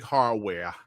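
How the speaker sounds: drawn-out New York "hardware"; New York accent. Acted. Streamer imitating how New Yorkers say hardware, in a New York accent. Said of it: "as the New Yorkers would say".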